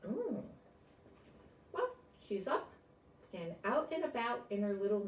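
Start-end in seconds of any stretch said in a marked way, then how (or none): none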